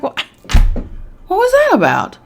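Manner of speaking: startled by the flash; baffled. Retold or imitated. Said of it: "I was like".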